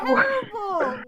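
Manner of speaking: slow, high, loud cry of despair; anguished shout. Real, not acted. Streamer wailing at a terrible fanfic, anguished shout. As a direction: wail it out in real pain at the writing.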